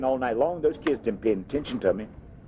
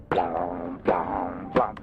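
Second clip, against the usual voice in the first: lower, humming the guitar's pulsing beat; low mock guitar blum; 7 semitones lower, much slower than usual. Telling the interviewer how his guitar sounded, low mock guitar hum. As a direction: sound out the guitar like a lazy twang.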